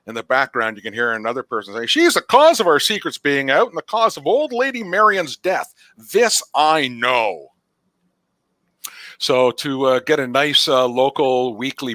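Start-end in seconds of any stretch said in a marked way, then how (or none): 1.9-7.5 higher villager voice; a townsman blaming the witch; accusing villager. Acted.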